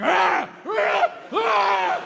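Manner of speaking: loud high yelps; screaming. Acted. Comic yelling at the crowd, loud and high. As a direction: yell out wildly at the crowd.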